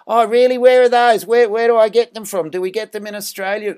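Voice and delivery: high, loud Australian playing innocent; put-on Australian accent. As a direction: feign ignorance in a hammed-up Australian accent. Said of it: "put the accent on and go".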